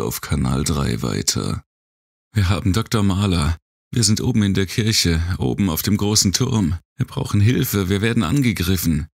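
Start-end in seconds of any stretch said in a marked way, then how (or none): 2.3-9.1 fast, high urgent radio call; a girl begging for help over the radio; young girl voice. A narrator's reading.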